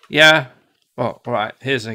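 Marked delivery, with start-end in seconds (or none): 0.1-0.5 loud mock reply. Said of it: "D H C P will go".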